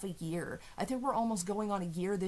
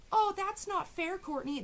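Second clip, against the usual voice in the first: much higher whiny mock voice; mock whiny defender; 11 semitones higher than usual. Mocking a defender's whiny protest in a high voice. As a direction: whine it like an offended fan. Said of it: "imagined defender reply".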